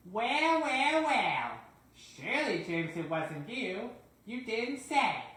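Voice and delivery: high, loud sing-song taunt; mock teasing. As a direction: needle him with fake surprise. Taunting James over accident.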